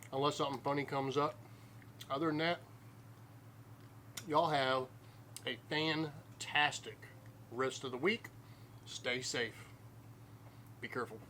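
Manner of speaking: usual speaking voice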